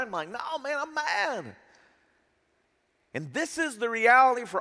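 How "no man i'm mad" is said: acted protest. Acted angry reply to advisers.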